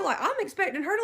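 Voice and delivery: ordinary delivery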